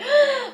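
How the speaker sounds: startled gasp. Pretend. Inner child panics.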